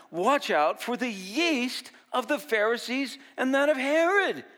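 high, loud warning cry; urgent warning to the disciples, high and loud. Retold or imitated. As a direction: call out the warning, rising. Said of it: "Jesus warned them".

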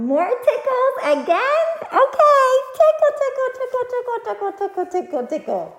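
high loud tickle chant, sliding down; giggly tickle attack. Real, not acted. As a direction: squeal it, then settle. Another round of tickles.

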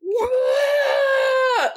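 high, excited exclamation; high delighted whoop; excited whoa. Retold or imitated. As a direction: shout out a thrilled whoa. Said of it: "he's kinda like".

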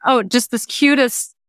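usual speaking voice

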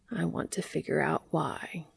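whispered, drowsy and soft; sleepy murmur. A narrator's reading. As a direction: murmur it as you drift off to sleep. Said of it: "as he murmured".

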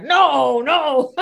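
loud, high mock cry of refusal; upset mother. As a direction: imitate a horrified mother, ending in laughter. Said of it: "My mother was like".

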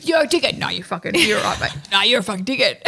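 lightly voiced Australian bloke, laughing; a hint of a brash Aussie telling a mate off; Aussie impression. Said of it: "What does he say?".

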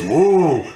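high, loud whoop from the crowd; swooping excited "woo"; cheering woo. Acted. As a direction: imitate a supporter cheering from the stands. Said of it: "you hear Ted out there".

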